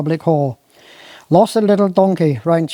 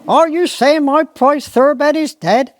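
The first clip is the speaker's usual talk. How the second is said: high, appalled squire; disbelief; 9 semitones higher than usual. Acted. Squire asks gamekeeper if his horse died, appalled. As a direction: squire can't believe his ears.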